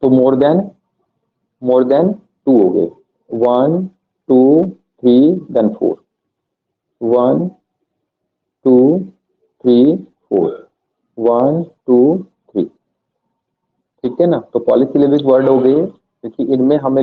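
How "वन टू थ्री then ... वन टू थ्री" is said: slow counting. Shown as a demonstration. Teacher counting vowels aloud for the class, slow. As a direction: count each one out slowly with pauses. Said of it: "counting vowels slowly".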